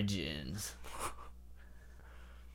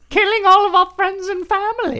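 squeaky character voice; 9 dB louder than usual. Pretend. Joins the British skit.

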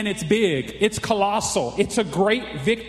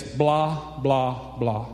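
mocking blah blah; 8 semitones lower, much slower than usual. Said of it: "that big giant running his mouth".

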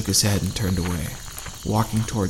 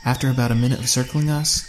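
ordinary delivery

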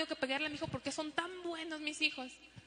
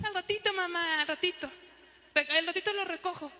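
higher whiny dragging stall; lazy stalling kid; 3 semitones higher than usual. Retold or imitated. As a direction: drag it out, kid avoiding chores. Child stalling. (niño flojo dando largas a mamá)

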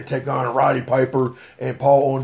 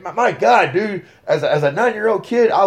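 excited; 7 semitones higher, 4 dB louder than usual. Real, not acted. Reliving childhood thrill at match.

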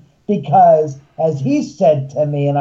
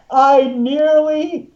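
laughing; 7 semitones higher, slower than usual. Real, not acted. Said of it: "dying of laughter".

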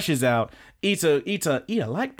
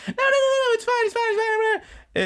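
very high, loud squealing no's; very high girl panicking; panicked anime girl; 3 dB louder than usual. Retold or imitated. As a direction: squeal it out to cut him off. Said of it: "And she's like".

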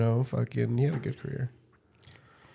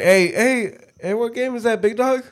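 higher, mock teacher calling out to a student; 10 semitones higher, 4 dB louder than usual. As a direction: play the nosy teacher asking what game it is. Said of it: "Fucking teacher's like".

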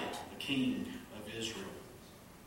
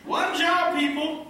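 very high and loud, exasperated; exasperated exclamation; 12 dB louder, slower than usual. Real, not acted. Pastor to congregation, high loud mock despair.

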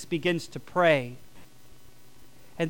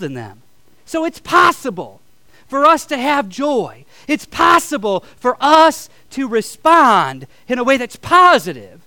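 higher and louder, stretching out the word possible; preaching at full force; 7 semitones higher, 9 dB louder than usual. Acted. Pastor assuring the church joy is possible, full-force preaching. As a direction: preach the promise of joy, building.

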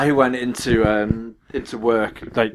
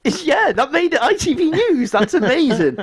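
retold excitement. Learning from mum it made the news, overjoyed. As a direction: replay being thrilled, pitched way up. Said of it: "I just got so excited about it".

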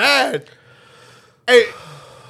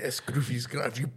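low murmured jingle; 11 semitones lower, 13 dB quieter than usual. Pretend. Repeats jingle, lower and quieter.

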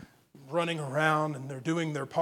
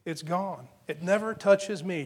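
usual speaking voice

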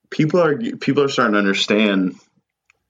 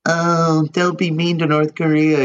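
high mock whiny listener voice; 6 semitones higher than usual. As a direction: mimic the squeamish fans, pitched up. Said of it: "They're like".

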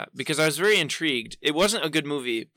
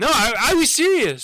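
emphatic protest; 9 semitones higher, 5 dB louder than usual. Real, not acted. Protesting he was not joking.

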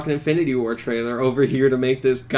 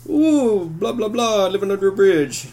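lightly voiced fantasy troll, much higher; mock troll voice; 8 semitones higher, slower than usual. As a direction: ham up a silly storybook creature. Mocking fantasy trope creatures.

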